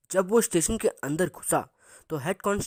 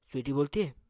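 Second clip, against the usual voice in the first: usual speaking voice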